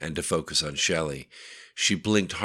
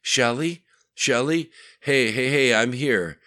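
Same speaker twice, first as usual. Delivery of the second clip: higher, louder anxious calls of her name; louder, higher worried reassurance; urgent worried calling; 8 semitones higher, 7 dB louder than usual. Acted. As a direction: rush to her side calling out. Rushes to injured woman's bed.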